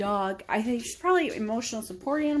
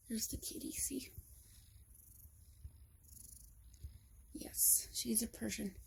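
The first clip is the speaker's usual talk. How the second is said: whispering; 18 dB quieter than usual. Real, not acted. Showing sleeping cat quietly.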